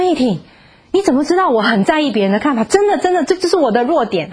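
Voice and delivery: amazed exclamation, pitched high; how did you know, said in wonder; surprised. Retold or imitated. Sister amazed the pastor read her.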